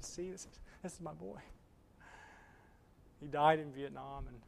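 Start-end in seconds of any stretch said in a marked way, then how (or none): 0.0-1.4 soft, hushed pride; proud father. Acted. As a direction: show off his boy quietly, tenderly. Proud father showing son's portrait.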